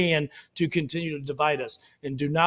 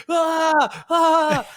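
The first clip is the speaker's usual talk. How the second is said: high, loud hammed-up scream; exaggerated howl, pitched well up; mock scream; 11 semitones higher, 3 dB louder than usual. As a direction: wail like the panicking kid. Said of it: "was screaming".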